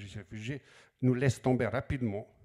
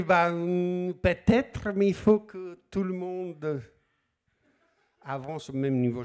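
higher, hesitant teacher voice; 7 semitones higher, 5 dB louder, slower than usual. Retold or imitated. Teacher stalling the eager refugee, high and hesitant. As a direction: play the evasive teacher, drawn out.

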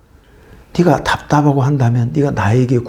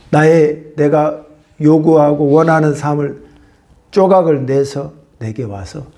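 usual speaking voice

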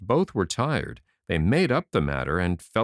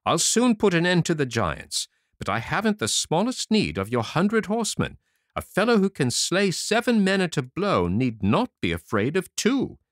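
higher, brisk and cocky; boastful little tailor; 8 semitones higher than usual. A narrator's reading. Tailor bragging to the king's messenger, high cocky voice. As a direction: puff yourself up like a tiny hero.

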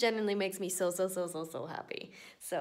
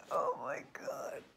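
whispered groan; 6 semitones lower, 5 dB quieter, slower than usual. Real, not acted.

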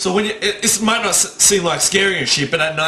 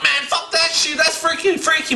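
much higher, spooked self-quote; 11 semitones higher than usual. Retold or imitated. Man alone at night hearing floor creak, spooked. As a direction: act out getting creeped out, pitch up high.

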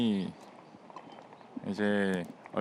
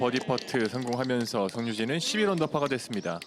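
loud rising call as the birdie drops; excited golf call; 2 semitones higher, 4 dB louder than usual. Real, not acted. (버디 퍼트에 흥분한 골프 중계)

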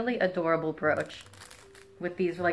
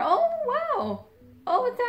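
high delighted gasp of wow; light up with surprise; surprised. Real, not acted. Surprised by card in package.

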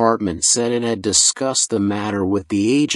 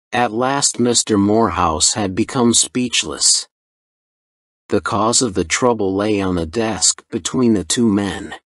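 plain reading voice

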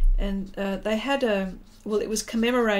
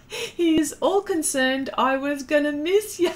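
high, laughing read-out; giggly and raised in pitch; amused, laughing; 7 semitones higher, 4 dB louder than usual. Real, not acted. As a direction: read it out through your laughter. Amused reading of viewer comment.

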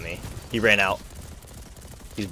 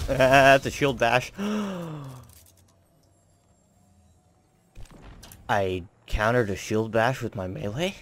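amazed disbelief; 4 semitones higher, slower than usual. Real, not acted. Amazed at own counter.

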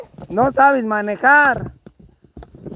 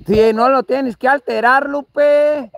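normal talking voice

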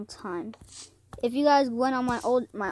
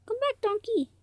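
pleading call. Real, not acted. Player whose donkey left, pleading call. Calling after the lost donkey.

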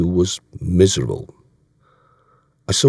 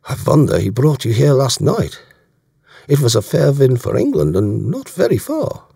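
put-on higher German-accented officer; German accent, pitched up and conversational; German commander, probing; 7 semitones higher, 4 dB louder than usual. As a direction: remark pointedly in a German accent. Voicing German commander in dialogue.

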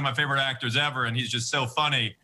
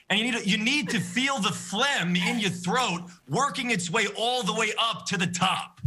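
higher, careful lecturing doctor voice; Doctor Fauci impression; 7 semitones higher than usual. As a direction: explain it patiently like a public health expert. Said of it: "Okay, Doctor Fauci".

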